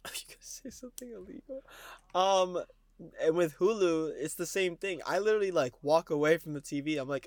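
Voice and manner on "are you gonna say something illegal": laughing conspiratorial whisper. Real, not acted. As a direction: whisper it like a secret. Teasing whispered question.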